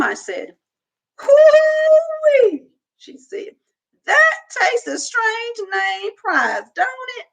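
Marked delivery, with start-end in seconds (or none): 1.2-2.7 very high, loud whoop; old woman whooping at a funny name; delighted exclamation. A narrator's reading. Gloria Dump exclaims. 4.1-7.3 put-on old woman teasing about the name; old woman asking the girl about the store; tickled old woman. A narrator's reading. Gloria Dump voiced.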